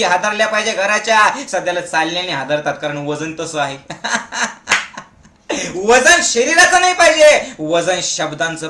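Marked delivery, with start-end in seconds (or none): 3.8-5.0 short burst of chuckling; laugh. Real, not acted. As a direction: laugh at your own joke. Laughs after own joke. (हसणे)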